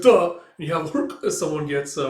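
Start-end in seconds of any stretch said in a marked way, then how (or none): 0.0-0.4 a loud, higher cut-off grunt; mock choked grunt. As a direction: grunt as if struck down. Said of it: "It's like".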